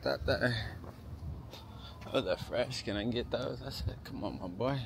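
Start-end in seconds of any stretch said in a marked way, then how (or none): none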